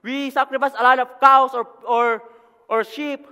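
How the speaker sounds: high, loud, impassioned pulpit delivery; full-force preaching. Real, not acted. Preacher telling the congregation why Jesus came down, preaching at full force. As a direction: drive each phrase out loudly to the congregation.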